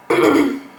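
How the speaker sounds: loud throat clear. Real, not acted. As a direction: clear your throat sharply.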